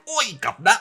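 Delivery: high-pitched, hammed-up Cockney call; mock Cockney. Imagining Bayonetta as a Londoner, hammed-up Cockney. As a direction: holler it like a cartoon Cockney. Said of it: "Oh, now Cockney".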